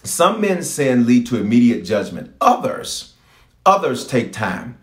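others drawn out slow with a pause; slow scripture emphasis. Retold or imitated. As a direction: linger on others, slowly. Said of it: "the scripture says this".

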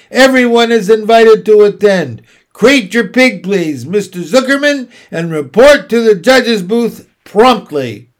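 loud, high official announcement; loudspeaker announcement. A narrator's reading. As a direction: project it like a fairground announcer on the loudspeaker. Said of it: "a voice was heard on the loudspeaker".

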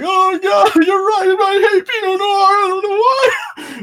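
high wailing sob-shout; mock crying. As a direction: wail it like a broken man. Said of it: "like, crying".

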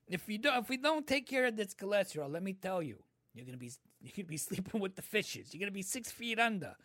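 high, quick mock-mobster patter; mob doctor voice. Doctor warns about cholesterol, quick high mobster voice. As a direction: rattle off a joking mob threat. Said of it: "Yeah, mob doctor".

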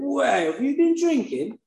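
high, mocking cop voice. Cop grilling the drunk driver, high mocking voice. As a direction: sneer the question like a smug cop. Cop questioning him, mocked.

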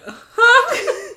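high, loud comic bafflement; Edwina. Acted. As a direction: blurt a startled, hammed-up question. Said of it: "Edwina would've been like".

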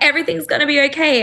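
a quick bright promise that it'll be fine; hurried soothing words to a friend; reassuring. Retold or imitated. Comforting friend during hug.

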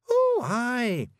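high, loud, casual Scots agreement; breezy Scottish Feegle. A narrator's reading. Rob treating entering heads as everyday, breezy. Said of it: "as if that sort of thing happened every day".

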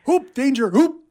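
high, loud panicked yelp; goat's panicked cry. Retold or imitated. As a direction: yelp it like a startled goat. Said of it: "it's like".

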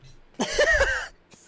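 sudden loud burst of laughter; loud high laugh. Real, not acted. Laughing hard at the cat-throwing threat. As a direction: crack up loudly at the joke.

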